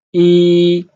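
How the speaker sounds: E held long on a high, level pitch; sing-steady, loud E with no fall. Shown as a demonstration. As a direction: stretch E out high and even. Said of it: "the first tone".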